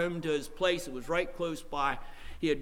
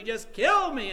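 raised, loud retold cry; imitated yelling; 7 semitones higher, 6 dB louder, slower than usual. Preacher retelling a drunk man's plea, raised voice. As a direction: cry out as the drunk man, partly held back. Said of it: "He was yelling it just".

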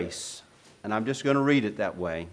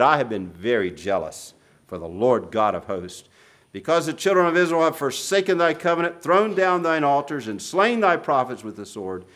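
preaching at full force; 3 semitones higher than usual. Acted. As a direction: proclaim David's lament at full force. Preaching scripture loudly.

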